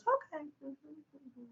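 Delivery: soft, high "okay", fading to tiny murmurs; very calm. Retold or imitated. Nutritionist hearing the whole medical history, very calmly. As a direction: imitate someone calmly taking it all in. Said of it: "she just very calmly sat".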